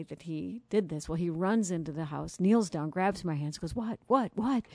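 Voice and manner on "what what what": anxious, higher urgent what what what; a husband rushing in, frightened for her; a touch of panic, pitched up; concerned. Retold or imitated. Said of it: "So concerned".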